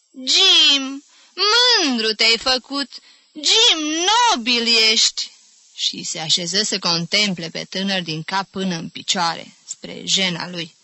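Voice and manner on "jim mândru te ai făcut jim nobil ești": higher, louder gushing admiration; pathetic and precious. A narrator's reading. Fond aunt admiring returning nephew, gushing. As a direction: gush over him theatrically. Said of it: "zise patetic și prețios".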